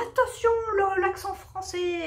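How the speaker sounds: high-pitched mock warning; fussy English teacher. English teacher correcting a student's French accent, mock scolding. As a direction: play a nitpicking teacher, pitched well up. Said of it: "la prof d'anglais qui va dire".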